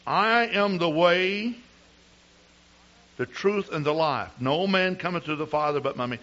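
preaching. Acted. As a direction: proclaim it with full force. Preaching scripture at full force.

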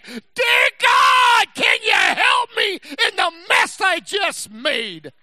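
high, loud, crying plea to God; desperate shouted prayer. Acted. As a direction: shout it up to God near tears. Preacher acts out a desperate prayer.

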